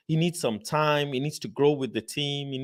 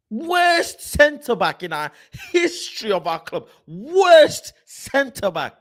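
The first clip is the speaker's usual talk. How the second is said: mocking loud rant; 6 semitones higher, 6 dB louder than usual. Real, not acted. Ranting at Maguire on screen.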